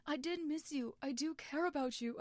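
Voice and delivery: pleading with a furious brother; earnest plea. Acted. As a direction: insist you care. Wilbur pleading continues.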